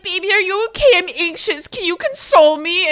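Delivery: put-on high, loud, whiny baby voice; mocking her clingy drunk self to her boyfriend, high and whiny. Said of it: "and then I'm sitting there being like".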